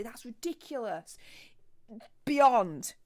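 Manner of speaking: normal talking voice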